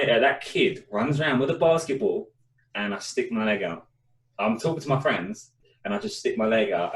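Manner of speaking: ordinary delivery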